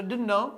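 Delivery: don't know with no I; dropped I. Shown as a demonstration. Teacher drilling the class to say don't know. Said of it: "बोलो".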